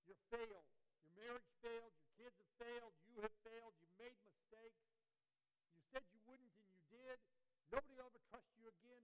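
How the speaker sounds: loud, high, accusing voice; a loud scolding voice of the world. Acted. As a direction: hurl every failure at the listener. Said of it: "the world would look at you and say".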